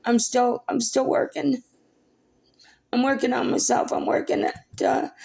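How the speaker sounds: high, tearful self-reassurance; emotional, high-pitched and wavering on; choked up. Real, not acted. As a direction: hold back tears while promising to cope. Holding up, still emotional.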